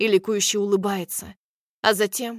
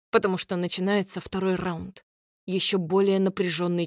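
ordinary delivery, nothing marked

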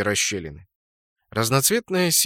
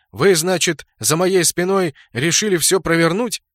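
high, raised accusing voice; angry accusation; 6 semitones higher, 5 dB louder than usual. A narrator's reading. Clan leader accuses subordinates of plotting, angry. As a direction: confront the plotters, voice up.

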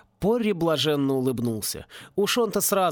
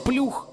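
high splashy plop; splash sound; 10 semitones higher than usual. A narrator's reading. Spirit's splash reply.

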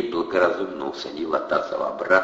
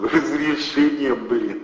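slow, higher mocking drawl; scornful thug; 9 semitones higher, 4 dB louder, much slower than usual. A narrator's reading. Thug sneers at the permit idea, slow and mocking. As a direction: sneer at the word.

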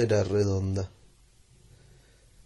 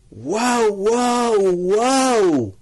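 much higher, louder excited yell; shouted wow; 10 semitones higher, 7 dB louder, much slower than usual. Acted. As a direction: shout it at the moon. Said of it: "Y ahora sí que gritó".

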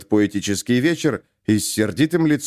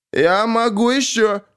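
put-on drunken offer; 10 semitones higher, slower than usual. A narrator's reading. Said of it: "Капитан пьяно улыбнулся".

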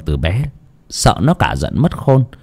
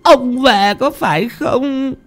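put-on high, slow, wailing old woman's voice; desperate old woman calling; slower than usual. Widow calling her dead husband's ghost, high wailing cry. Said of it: "kêu lên trong vô vọng".

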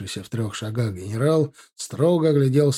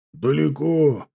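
moderately raised call back; 2 semitones higher, slower than usual. A narrator's reading. Lookout answering his officer from afar, calling back. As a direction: answer as if from a distance. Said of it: "откликнулся тот".

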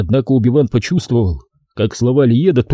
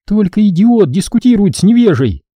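mock yelled, much higher; furious yell; 9 semitones higher, 3 dB louder than usual. Girl yelling at the brother in fury. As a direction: hurl the insult. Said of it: "разъярённо проорала она".

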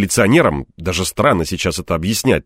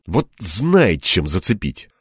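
usual speaking voice

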